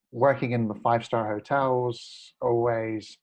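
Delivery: ordinary delivery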